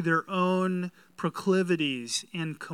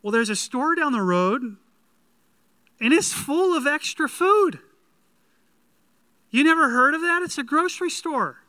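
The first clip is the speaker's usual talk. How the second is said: incredulous; 9 semitones higher, 8 dB louder than usual. Acted. Homeowner explains, incredulous.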